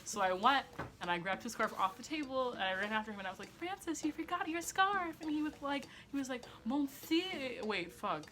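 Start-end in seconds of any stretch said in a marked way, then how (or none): 3.6-5.1 high, slow sweet call after him. Retold or imitated. Girl running after her crush with his scarf, high and slow. 6.6-7.6 put-on a light French accent on "Merci". French boy thanking the girl, light French accent. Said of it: "How do you speak French?".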